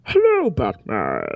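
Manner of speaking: low, slow, through a tin can; menacing masked villain greeting; Bane voice. Acted. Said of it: "Bane impression".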